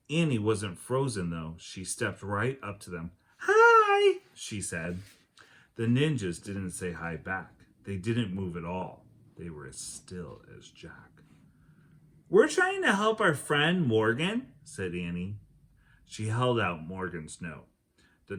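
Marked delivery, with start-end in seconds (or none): 3.4-4.2 put-on high, loud, chirpy little girl hi; bright girl's hi. Annie greeting the silent ninjas, high chirpy hi. 12.3-14.5 put-on high, louder voice of an eager young girl; eager girl's voice. Annie explaining to the ninjas, high eager girl voice.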